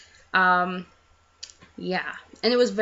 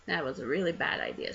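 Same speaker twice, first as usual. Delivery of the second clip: quiet aside; 4 semitones lower, 8 dB quieter, faster than usual. Real, not acted. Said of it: "self-scolding aside".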